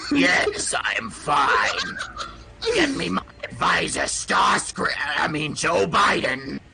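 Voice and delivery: booming robot villain voice; Megatron as Obama. Pretend. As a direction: shout it like an evil robot president. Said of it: "he's like".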